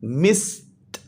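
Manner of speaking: मिस्ड with a detached "t" at the end; ending pronounced t. Shown as a demonstration. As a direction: say मिस्ड as मिस... ट. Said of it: "फिर टी की आवाज़ है". (मिस्ड के आख़िर में अलग से "ट")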